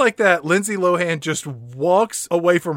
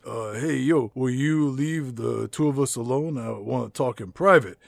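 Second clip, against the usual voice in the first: Matt Dillon voice; 4 semitones lower than usual. Retold or imitated. Podcast host voicing Matt Dillon to his cohost, low mock creep.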